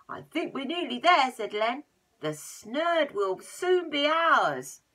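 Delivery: loud, slow gloating; greedy gloat. Acted. Len gloating the snurd will soon be theirs, slow loud gloat. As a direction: drag it out, sure of the prize.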